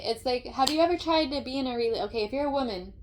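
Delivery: usual speaking voice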